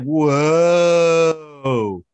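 long, loud drawn-out whoa; amazed whoa. Retold or imitated. Looking out the window, loud and awestruck. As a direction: let the whoa stretch out in awe. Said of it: "I was like".

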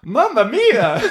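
loud, high stage-Italian cry; hammed-up Italian accent, exclaimed loud and high; mock Italian exclamation. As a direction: shout it like a cartoon Italian. Reacting to Ross kissing Chandler's mom.

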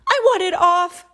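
high, loud mock wail; storybook wail. A narrator's reading. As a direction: wail in comic discomfort. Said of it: "wailed Tomato".

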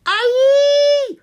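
long, piercing high monster shriek; creature screech. A narrator's reading. Monster interrupts the boys with a piercing screech. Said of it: "the creature screeched again".

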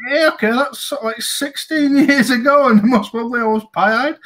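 wry inner thought. Retold or imitated. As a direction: roll your eyes at the memory. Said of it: "And you're thinking".

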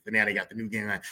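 ordinary delivery

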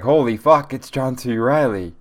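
excited exclamation. Retold or imitated. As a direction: blurt it out, starstruck. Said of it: "and I was like".

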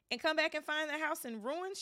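high, loud snarl. A narrator's reading. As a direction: spit it out angrily. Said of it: "she snarled".

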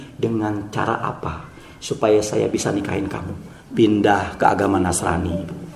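low, hushed voice; soft acted dialogue. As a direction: drop to a soft, low murmur. Muezzin woos woman, she sets condition.

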